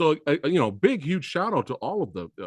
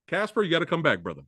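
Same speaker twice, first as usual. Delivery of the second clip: ordinary talk, nothing marked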